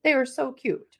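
usual speaking voice

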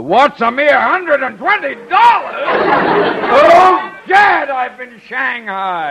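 high, loud anguished wail; dismayed shout. Acted. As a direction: howl it out in shock. Conklin realises he's been swindled.

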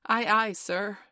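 loud, high, eager response; high-pitched, dutiful shout; obedient sailor's reply. A narrator's reading. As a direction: sing out like a new recruit answering the captain. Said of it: "Say, 'Aye, aye, sir.'".

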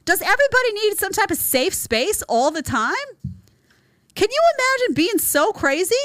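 high, incredulous rant; outraged disbelief. Real, not acted. Host ranting at the easily offended, high and incredulous. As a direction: pitch it up in disbelief.

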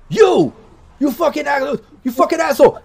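furious shout of recognition at Tomcat; high, loud, outraged yell; angry yell. Acted. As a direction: yell it, you know exactly who this is. NPC recognizes and yells.

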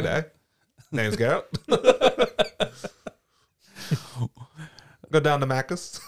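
put-on a lightly done Australian accent; hint of an Australian twang; mock Australian accent. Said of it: "talks in an Australian accent".

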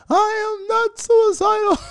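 high, loud, slow shrieked denial; hammed-up hysterical wail, pitched high; mock screaming plea. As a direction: screech it like a terrified man pleading.

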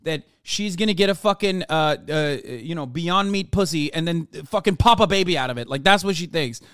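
normal talking voice

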